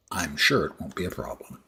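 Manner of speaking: dry, quick sarcastic aside. Acted. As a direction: say it meaning the opposite. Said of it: "Uh, that was sarcasm".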